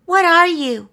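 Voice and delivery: put-on high, small child zebra voice; shy little zebra. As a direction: peek out and ask timidly.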